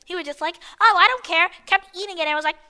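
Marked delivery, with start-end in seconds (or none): 0.8-1.5 louder, higher mock of a careless kid; lightly mocking kid voice; mocking dumb kid. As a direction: imitate him blurting it out. Mocks the kid's careless reply.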